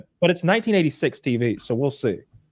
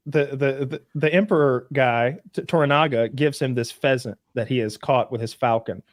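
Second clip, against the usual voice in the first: ordinary talk, nothing marked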